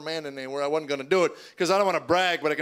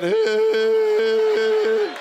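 much higher, louder squeaking yelp; voice crack; 6 dB louder than usual. Retold or imitated. Teen son calls to dad, voice cracking. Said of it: "He had that crackling thing".

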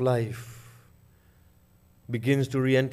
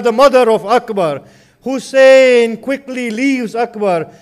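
rising, impassioned elegy for Karbala; mournful majlis recitation; 6 semitones higher, 8 dB louder than usual. Acted. Preacher recounting Akbar's death to mourners in lamenting chant. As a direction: chant the tragedy with swelling grief.

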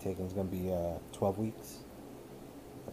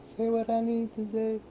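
teasing echo; 13 semitones higher, 4 dB louder than usual. Real, not acted. Teasing repeat of her words.

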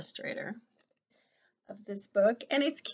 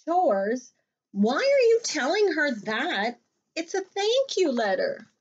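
higher, louder rabbit, baffled; puzzled Rabbit; 6 semitones higher, 6 dB louder than usual. Acted. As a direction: Rabbit can't believe it. Rabbit voiced in a read-aloud.

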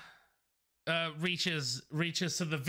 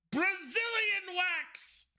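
grand spell call; 13 semitones higher, much slower than usual. Acted. Mock spellcasting.

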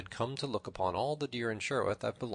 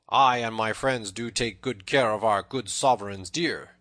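plain reading voice

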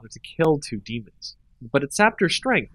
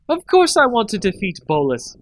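higher, louder indignant protest; 9 semitones higher, 5 dB louder than usual. A narrator's reading. Liliana defending herself to Nissa, indignant protest. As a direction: push back hard against the charge. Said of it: "Liliana protested".

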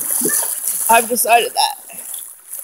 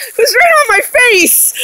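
laughing; 10 semitones higher, 3 dB louder than usual. Real, not acted. Laughing after spider scare.

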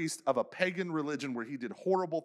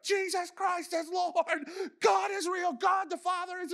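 preaching shout; 14 semitones higher than usual. Retold or imitated. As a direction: proclaim from the chair to the whole room. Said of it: "he starts preaching".

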